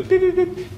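high imitated electronic beeps; satellite beeps. Lecturer mimicking a wellhead signal, high beeps. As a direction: beep like a data transmitter.

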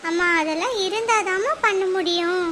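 put-on teen daughter voice. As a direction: ask mum for art supplies gently.

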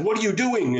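high-pitched angry outburst. A narrator's reading. Angry master to student after his book is burned, angry. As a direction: demand it, voice leaping up. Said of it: "got very angry and he said".

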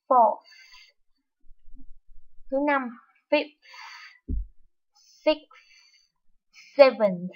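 each ordinal from "fourth" to "tenth" ending on a strong puffed th; breathy final th. Shown as a demonstration. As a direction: blow air out hard on every final th. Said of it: "cố gắng mình bật hơi ra nhiều hơn".

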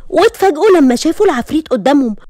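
usual speaking voice

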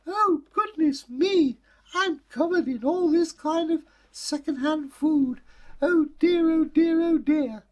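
put-on a child squealing in dismay; shrieking little girl. Girl splashed with mud by sheep shrieks high.

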